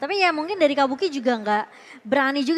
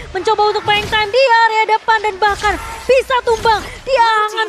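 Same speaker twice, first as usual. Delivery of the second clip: loud, high, fast hype commentary; excited play-by-play; 6 semitones higher, 5 dB louder than usual. Acted. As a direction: call the teamfight at full volume.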